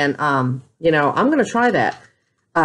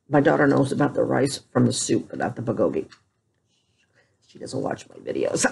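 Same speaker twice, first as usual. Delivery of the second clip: much softer and lower, hushed; secret aside; 9 semitones lower, 7 dB quieter than usual. Real, not acted. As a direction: let the audience in on a secret. Secret aside about daughter.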